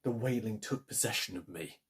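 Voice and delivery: usual speaking voice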